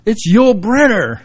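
high, loud, slow starstruck call; mock excited high mom voice. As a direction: point and exclaim like a thrilled mother. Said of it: "And my mom goes".

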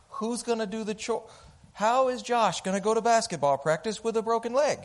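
high, fast, fretting mother-in-law voice; frantic mother-in-law. Retold or imitated. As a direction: panic over every cost like an anxious in-law. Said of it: "I am talking about my mom-in-law".

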